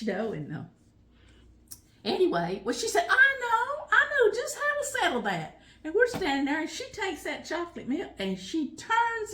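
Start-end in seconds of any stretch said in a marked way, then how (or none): 3.1-5.6 high, loud teasing mom voice; mother's sly announcement. Retold or imitated. Mother settling the kids' fight, high and teasing. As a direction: play the mother who has a sneaky plan.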